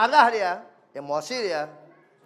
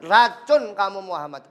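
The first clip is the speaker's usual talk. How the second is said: usual speaking voice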